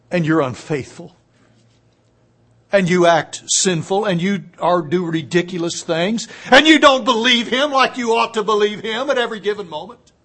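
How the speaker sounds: rising sermon intensity; impassioned preaching. Real, not acted. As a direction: drive the list home to the listeners. Preacher listing failings.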